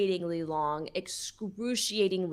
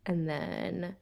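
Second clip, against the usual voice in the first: usual speaking voice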